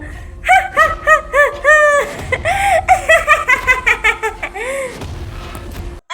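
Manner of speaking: long high evil cackle, fading; witch cackle. Acted. Witch leaving with the child, high evil cackle. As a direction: let out a triumphant witch laugh. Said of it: "इस चुड़ैल".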